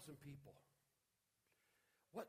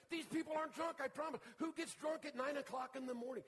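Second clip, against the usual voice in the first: very loud and much higher, triumphant; preaching at full force; 13 semitones higher, 13 dB louder than usual. Acted. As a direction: shout the promise to the crowd.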